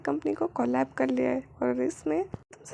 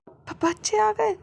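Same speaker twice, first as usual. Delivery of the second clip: high, louder, slow stammering alarm; panicked stammer; 7 semitones higher, 4 dB louder, slower than usual. Acted. Wing warning that the kids have arrived, panicked. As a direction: blurt it out in panic.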